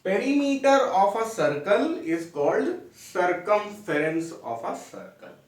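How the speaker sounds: very slow, each word spaced for note-taking; slow dictation. Shown as a demonstration. Teacher dictating a definition to students, very slow and even. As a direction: spell it out slowly so students can write.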